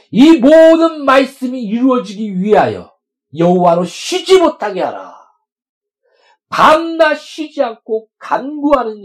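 loud, high proclaiming of scripture; fervent loud scripture reading; preaching at full force. Acted. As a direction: ring it out over the congregation.

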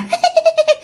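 high-pitched mocking ghost giggle; leering giggle. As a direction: giggle tauntingly, high and impish. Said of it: "nó vừa cười nham nhở". (cười khúc khích nham nhở, giọng cao)

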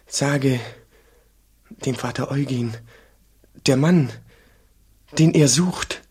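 laut und feierlich verkünden, zum Ende hin langsamer; eindringliche Traumstimme. Retold or imitated. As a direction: wie ein Bote aus dem Traum, laut und gewichtig. Traumgestalt spricht Botschaft. (der junge Mönch gibt dem Vater laut die Worte der Traumgestalt wieder)

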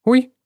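high startled squeal; girl squealing in alarm; squeak. A narrator's reading. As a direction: yelp in surprise. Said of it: "взвизгнула девушка".

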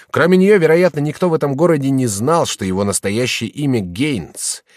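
plain reading voice

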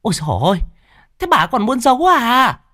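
high piercing nagging wife; a shrewish daughter-in-law screeching; shrill shrewish voice. A narrator's reading. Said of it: "giọng đã the thé". (giọng the thé chua ngoa)

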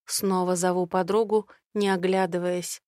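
plain reading voice

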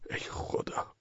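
whispered aside to God; barely voiced, helpless exclamation; whispered dismay. A narrator's reading. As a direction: whisper it in despair. Narrator despairs on being seized.

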